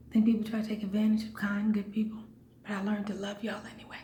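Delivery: soft unvoiced whisper, a bit fast; whispered aside. Real, not acted. To her viewers, a whispered aside about kindness. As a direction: lean in and confide it under your breath.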